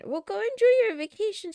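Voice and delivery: high, loud mock scolding; loud tease. Real, not acted. Teasing a chatter on vacation, loud playful scold.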